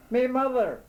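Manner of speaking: high, loud exasperated call; impatient call. A narrator's reading. Lost man shouting up to the owl, high and impatient. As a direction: shout it up, losing patience.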